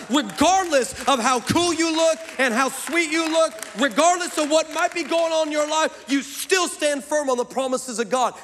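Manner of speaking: impassioned, high-pitched sermon delivery; full-force preaching. Real, not acted. Preacher urging church to stand firm on God's promises, impassioned preaching. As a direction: drive each phrase home, pitch climbing.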